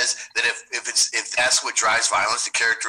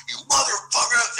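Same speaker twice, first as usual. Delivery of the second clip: much higher, heated curse; cussing someone out; 11 semitones higher than usual. Acted. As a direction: spit the insult while charging in. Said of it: "as I'm cussing you out".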